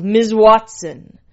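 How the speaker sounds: loud, slow cry of the name; drawn-out narrated shout; yelling. A narrator's reading. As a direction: yell it out at full climax. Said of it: "Holmes yelled".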